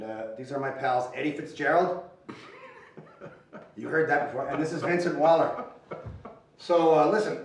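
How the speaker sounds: ordinary delivery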